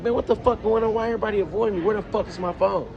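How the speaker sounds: high-pitched, fed-up questioning; indignant string of questions; exasperated. Retold or imitated. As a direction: reenact rising frustration, pitched well up. Retold frustration at being avoided.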